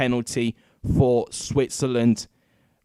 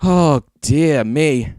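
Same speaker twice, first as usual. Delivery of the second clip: overexcited sigh; 5 dB louder, much slower than usual. Real, not acted. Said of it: "I'm just getting so overexcited".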